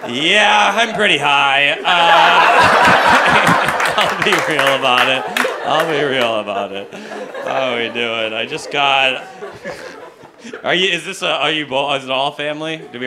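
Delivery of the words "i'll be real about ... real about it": ordinary talk, nothing marked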